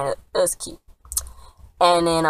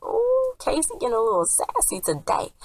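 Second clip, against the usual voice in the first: high squealing ooh then teasing; sassy tease; 9 semitones higher, 3 dB quieter than usual. Real, not acted. Viewer reacting to Casey's comeback, high teasing ooh. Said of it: "I was like".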